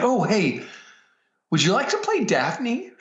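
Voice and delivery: playfully casual, as if it's no big deal; mock offhand offer. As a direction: toss the big offer out like nothing, way up high. Casually springing the role offer.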